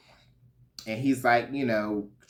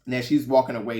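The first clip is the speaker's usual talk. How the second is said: ordinary delivery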